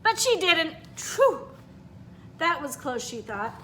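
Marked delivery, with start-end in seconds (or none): 1.0-1.5 high, bright phew of relief; big mock sigh of relief; relieved phew. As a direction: blow out relief. Relief after near mistake.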